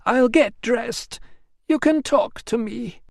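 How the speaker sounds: high, slow resigned female voice; Emilia. Acted.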